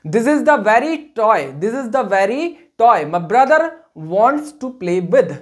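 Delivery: drawn-out word by word for copying; slow dictation. Shown as a demonstration. Teacher dictating an example sentence slowly to students. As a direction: spell it out slowly for the class. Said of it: "dictating example slowly".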